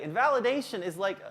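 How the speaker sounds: usual speaking voice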